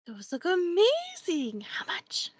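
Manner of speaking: breathless whispered wonder; gasp, then whisper in awe; awed whisper. Acted.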